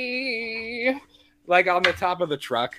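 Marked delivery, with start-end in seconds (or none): 0.0-1.0 put-on high, playful "Wee!" like a kid on a ride; gleeful wee. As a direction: squeal it up high for fun. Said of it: "and he's just like".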